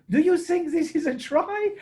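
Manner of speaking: high, loud, slow put-on question; Didier Mene. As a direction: imitate a referee urgently asking for a ruling. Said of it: "Didier said".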